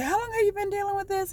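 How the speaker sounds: high, quick concerned doctor voice. Retold or imitated. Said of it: "she said".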